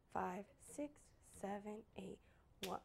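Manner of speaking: soft, low-key counting; hushed count-in. Real, not acted. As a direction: count them in very quietly. Quiet count-in before clapping.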